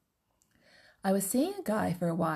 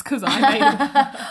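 laughing, louder and slower; 7 semitones higher, 11 dB louder, slower than usual. Retold or imitated. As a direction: laugh through the brag. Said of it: "I'm like".

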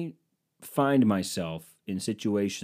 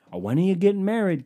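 high, louder mock-nagging question; mocking nosy question; 8 semitones higher, 5 dB louder than usual. An intrusive guest asking about marriage, mocking and high. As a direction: parody the pushy asker.

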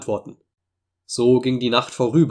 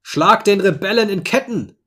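high, furious captain's order; enraged shout; 8 semitones higher, 4 dB louder than usual. A narrator's reading. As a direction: shout the arrest order, beside yourself. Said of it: "außer sich schrie der Kapitän". (außer sich geschriener Befehl)

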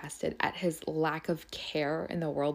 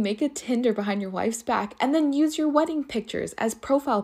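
higher, incredulous rant; indignant outrage; 5 semitones higher, 3 dB louder than usual. Real, not acted. Scolding a cheater for using wedding pictures, incredulous outrage. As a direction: rant in disbelief at the nerve of it.

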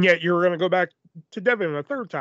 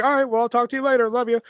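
high, loud, quick retold goodbye; animated casual farewell; breezy phone sign-off; 7 semitones higher, 4 dB louder, faster than usual. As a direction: toss off a friendly goodbye on the phone.

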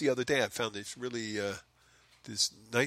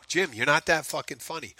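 raised, higher-pitched mock complaint; mock heckler; 6 semitones higher, 4 dB louder than usual. Listener tells the podcast host off, mock heckling. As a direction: throw it out like an imagined critic, pitched up.

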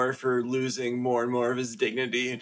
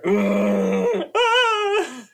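much higher, loud animal squeal; panda cry; 6 dB louder than usual. Acted. Friend imitating the panda, in a much higher loud squeal. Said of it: "pretty good panda sound".

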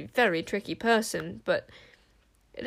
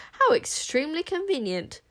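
arch, higher-pitched irony; sceptical; 8 semitones higher than usual. A narrator's reading. Enchanter answering the queen's excuse, archly sceptical. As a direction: lift the voice in mock admiration. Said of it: "raising an eyebrow".

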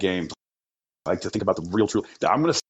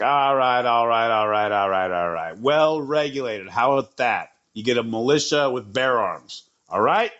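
put-on Australian accent. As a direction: play Madison with a rough Australian twang, slowing on "Well regulated". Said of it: "they got Australian accents".

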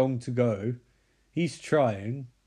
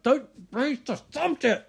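mock outburst; 12 semitones higher than usual. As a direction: blurt it in a strained high mock voice. Acting John's outburst in retelling.

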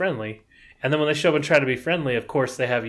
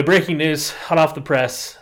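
mock news announcer; 3 semitones higher, 4 dB louder than usual.

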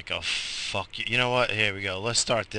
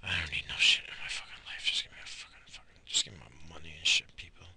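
paranoid whispered aside; whispering so no one hears; 4 semitones lower, 3 dB quieter than usual. Real, not acted. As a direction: hiss it under your breath.

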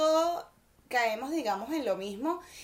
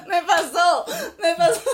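much louder, high words squeezed out through laughter; laughing hard; 6 semitones higher, 12 dB louder than usual. Real, not acted. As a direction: gasp it out while cracking up. Laughing while recalling it.

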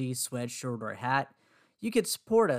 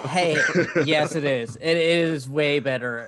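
laughing; 6 dB louder than usual. Real, not acted. Co-host teasing about accents.

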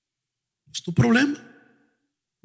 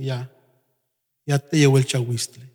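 low, soft private verdict; unspoken thought; 9 semitones lower, 6 dB quieter than usual. Retold or imitated. Pastor's silent thought about a stubborn member, low and soft. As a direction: think it quietly, done with them. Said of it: "lo pienso".

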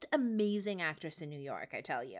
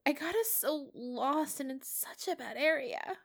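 much higher, trembling with guilt; a scared kid blaming himself; upset, distraught; 7 semitones higher than usual. A narrator's reading. Peter insists to Matt it was his fault, high and distraught.

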